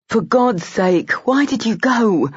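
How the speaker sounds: frustrated outburst at a friend; Colin, exasperated and pressing. Acted.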